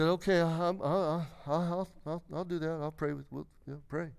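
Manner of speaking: soft, halting stammer fading away; hesitant agreement. Retold or imitated. Professor reluctantly agreeing to pray with a student, hesitant. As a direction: agree haltingly, trailing into a near mumble.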